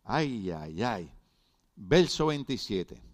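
ordinary talk

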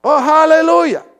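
high, slow, drawn-out hallelujah; preacher crying out in praise; fervent hallelujah. Real, not acted. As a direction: lift it up in praise. Exclamation of praise.

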